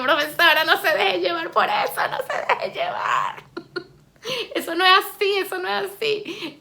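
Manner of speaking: high, loud mock pleading through laughter; laughing protest. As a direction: protest to the teacher, laughing as you go. Acted flustered protest to teacher.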